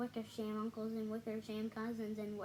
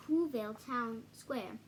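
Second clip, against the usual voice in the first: usual speaking voice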